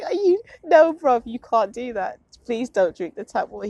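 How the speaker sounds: mock scolding. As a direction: scold him half-joking, bruv. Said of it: "I was like".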